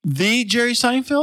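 high, slow amazed question; stunned that it's Seinfeld. Real, not acted. Amazed echo of the name.

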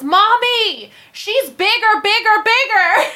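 put-on high, loud little boy announcing; hella loud kid. Small son tells mommy in the waiting room, loud child voice. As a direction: call it out high, stretching the last words. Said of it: "hella loud".

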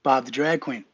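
grand host announcement; introducing Bob to the listeners; announcer intro. Acted. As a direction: bring the guest on like an emcee. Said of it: "do the introduction".

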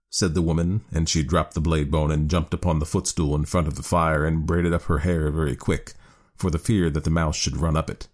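ordinary delivery

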